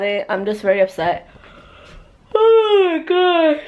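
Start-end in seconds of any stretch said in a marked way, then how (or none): 2.3-3.6 crying. Real, not acted. Upset about doctor news, crying.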